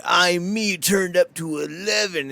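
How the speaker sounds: slightly high, slow mocking swagger; a hint of a smug wrestler; mock bravado. Mocking Macklin's gimmick with light smug swagger.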